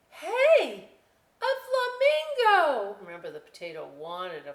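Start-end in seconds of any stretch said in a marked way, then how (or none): 0.1-3.0 high, loud, slow delighted cry; excited discovery. Acted. Potato spotting the flamingo, high thrilled cry. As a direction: gasp it out like a thrilled kid.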